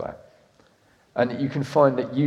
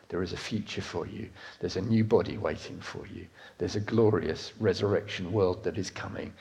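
much softer and lower, tender and sincere; tender low sermon; 7 semitones lower, 11 dB quieter than usual. Real, not acted. Preacher tells the congregation Jesus loves them, soft and tender. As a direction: ease right down, gently reassuring.

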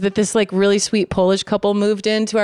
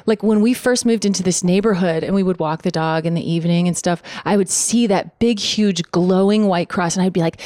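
ordinary talk, nothing marked

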